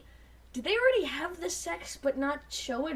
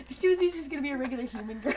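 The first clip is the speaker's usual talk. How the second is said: usual speaking voice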